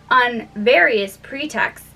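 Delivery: usual speaking voice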